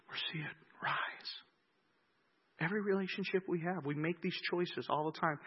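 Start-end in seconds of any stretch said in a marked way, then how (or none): 0.1-1.4 whispered. Acted. Quiet preaching moment.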